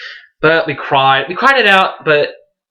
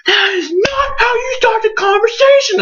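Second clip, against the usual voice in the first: much higher, shrill tearful shout; mom flipping out. Retold or imitated. Mom yelling at son over his clumsy coming out, high crying shout. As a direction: scold like a panicked mother. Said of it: "my mom flipped shit".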